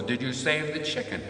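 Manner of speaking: light mock of mom asking; a hint of an eager old mother; mother's question. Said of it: "She goes".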